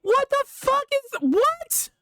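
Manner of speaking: a high, stunned shriek of disbelief; high-pitched what-the-hell squeal; shocked disbelief. Real, not acted. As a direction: squeal it out, completely thrown. Shocked by opponent's Pokemon.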